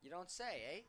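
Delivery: lightly put-on Canadian accent; a hint of mock Canadian. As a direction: slip in a playful Canadian "eh?". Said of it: "Canadian accent there".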